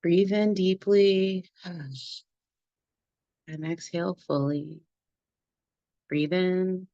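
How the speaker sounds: unhurried meditation guide with long pauses between phrases; guided meditation. Acted. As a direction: lead the group gently through the body, phrase by phrase.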